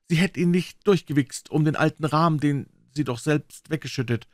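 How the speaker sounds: put-on a boy reciting a made-up dream; a hint of a sly boy telling his dream; Tom.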